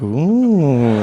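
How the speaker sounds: long drawn-out "ooh" of awe; impressed "ooh". Retold or imitated. Comedian reacting to the charmer's riddle, impressed ooh. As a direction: coo it long, as if deeply impressed.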